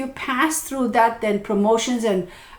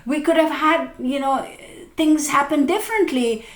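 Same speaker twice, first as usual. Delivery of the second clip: higher regretful partner voice; 6 semitones higher than usual. Retold or imitated. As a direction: plead with hurt regret. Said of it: "a lot of times it'll be like".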